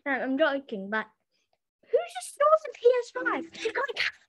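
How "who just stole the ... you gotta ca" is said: high-pitched, quick mock accusation; mock outrage. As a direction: squeal it like a joking accuser. Mock outrage over the stolen console.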